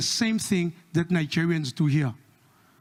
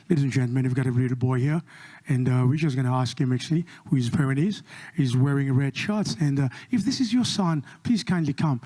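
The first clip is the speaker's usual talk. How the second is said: put-on page the lost boy's parents, deep and formal; deep supermarket announcer asking for a lost boy's parents; 6 semitones lower than usual.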